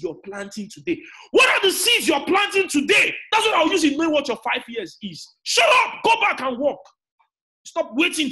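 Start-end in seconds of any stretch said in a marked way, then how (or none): 1.3-8.3 loud, high-pitched rebuke; shouted scolding. Real, not acted. Preacher scolding people who ignore their seeds, shouting. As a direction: shout it as a fierce challenge. Scolding the impatient audience at full force.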